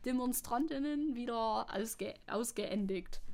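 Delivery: halting mock Saxon German; amateur Saxony accent, amused and unsure; Saxony accent attempt. Shown as a demonstration. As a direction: stumble through it in a put-on Saxon accent. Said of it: "try to read this with a Saxony accent".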